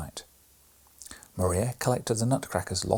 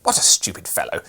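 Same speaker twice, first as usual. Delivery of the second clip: put-on scornful boy; 3 semitones lower, 8 dB louder than usual. A narrator's reading. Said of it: "said Fred".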